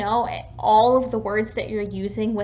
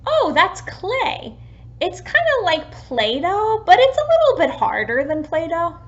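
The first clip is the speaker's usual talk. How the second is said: put-on teaching a child; 6 semitones higher, 6 dB louder than usual. Shown as a demonstration. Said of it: "Another example may be".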